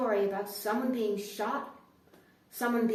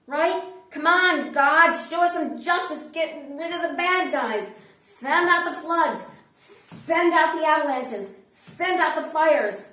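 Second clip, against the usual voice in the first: loud, higher rant at God; shouted plea; 5 semitones higher, 9 dB louder than usual. Acted. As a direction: demand justice from the heavens at full volume.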